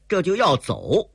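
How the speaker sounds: plain reading voice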